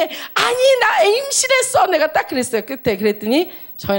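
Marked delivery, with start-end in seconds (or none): 0.0-1.9 exasperated. Retold or imitated. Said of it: "내가 답답해갖고".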